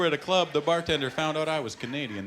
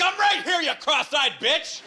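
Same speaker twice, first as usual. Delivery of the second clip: much higher, loud mock outburst; snapped yell; 4 dB louder than usual. Child yelling at the Romper Room host. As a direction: explode at the TV host. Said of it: "Finally, I just snapped".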